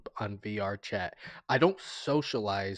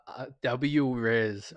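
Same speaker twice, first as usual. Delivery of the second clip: ordinary talk, nothing marked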